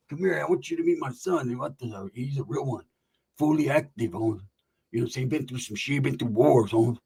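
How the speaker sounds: low, soft gangster uncle. Retold or imitated. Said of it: "[name]'s like".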